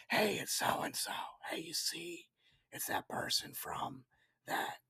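hushed whisper in a theater; whispering to wife. Retold or imitated. Whispering to his wife in the dark movie theater. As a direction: lean over and whisper the name. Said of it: "I'd whisper to Shannon".

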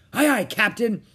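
high, loud obedient reply; pirate crewman. Acted. Pirates answering their captain, eager crewman voice. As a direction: answer the captain smartly as a sailor.

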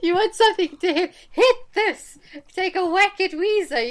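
high, loud hammed-up shriek. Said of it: "She said".

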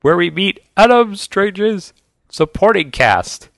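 high, slow grandiose announcing; playful heroic announcer voice; mock announcer. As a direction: draw it out like a show introduction.

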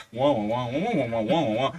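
low muffled wah-wah talk like the adults in Charlie Brown; lightly voiced muffled trombone talk. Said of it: "como los adultos de Charlie Brown".

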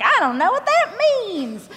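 high, slow bewildered self; clueless. Retold or imitated. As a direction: play dumb and lost. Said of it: "I was like".

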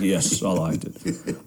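low, slow imitated assurance; mock deep voice. Friend insisting she liked the podcast, mock deep voice. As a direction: drop it low and draw it out. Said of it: "in deep voice".

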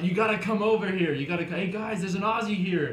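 loud, high retold party hollering; rally the guys over, imitating their excitement; excited calling out. Partygoers calling friends over to an Aussie, excited shouting.

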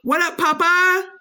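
high, loud hype-man voice. Retold or imitated. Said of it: "he goes".

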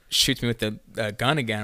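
ordinary talk, nothing marked

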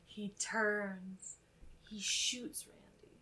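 hushed, suspenseful telling; dramatic whisper. Retold or imitated. Podcaster whispering the killer's twist to her cohost.

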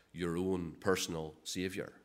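"your own personal" set apart word by word; quiet, deliberate weight on "personal"; stress on the personal. Shown as a demonstration. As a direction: lean on "your own personal", kept low and soft. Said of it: "it's the emphasis".

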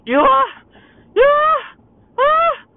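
very high, loud squealing falsetto; hammed-up high-pitched gushing; mocking squeal. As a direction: shriek it in a cutesy falsetto, mocking them. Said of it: "they think that it's so cool to say stuff like".

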